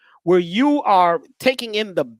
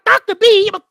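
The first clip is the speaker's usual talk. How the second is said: high, loud, flustered woman patient; mock-frantic lady, pitched way up and loud; 13 semitones higher, 6 dB louder than usual. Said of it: "she was like".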